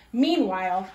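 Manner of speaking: plain reading voice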